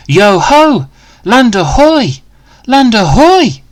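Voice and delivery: high, loud pirate cheer; excited cry. Acted. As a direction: shout it to the whole sea, thrilled. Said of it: "Danny cried".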